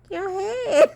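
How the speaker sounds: high, breaking into laughter; laughing. Retold or imitated. Answering her puzzled husband, laughing. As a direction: squeal it out through laughter. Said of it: "I was like".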